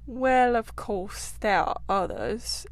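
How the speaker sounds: slow, halting and unsure; hesitant. Acted. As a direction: drag it out, reluctant to say. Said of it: "in a hesitant sort of way".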